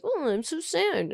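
high, soft whiny mock sadness; mock sad. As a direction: pout it out pitifully. Ex mocked as sad.

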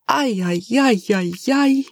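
lightly voiced Spanish-accented wail, rising; despairing lament. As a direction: moan it like a worried servant. Eustasio despairs.

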